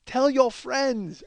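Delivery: lingering sing-out impression; parlor woman's farewell. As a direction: stretch each word, imitating the masseuse.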